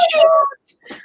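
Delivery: put-on high little toddler echo; toddler imitation. Toddler son parroting his dad, high and cute. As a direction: copy the kid repeating the word. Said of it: "And then my son going".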